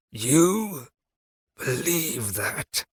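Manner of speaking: slow, faint rasp from the dark; barely alive, dragged words; corpse voice. A narrator's reading. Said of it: "that corpse voice".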